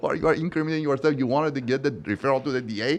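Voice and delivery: mock telling off the client; retold yelling. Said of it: "I was yelling at him".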